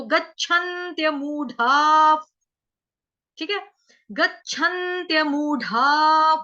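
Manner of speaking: slow, loud recitation. Shown as a demonstration. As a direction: intone the phrase slowly.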